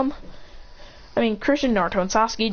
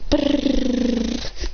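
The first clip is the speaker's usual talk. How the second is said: rolled buzzing "brrr" effect; mouth-made rumbling sound effect; imitated impact noise; 4 semitones higher than usual. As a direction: trill out the staff's slam.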